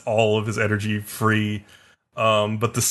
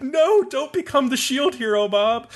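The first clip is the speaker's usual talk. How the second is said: mock pleading. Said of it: "And I was like".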